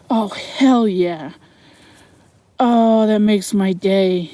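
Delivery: slow, savoring joy; drawn-out happy relief; delighted. Real, not acted. Delighted by the military button.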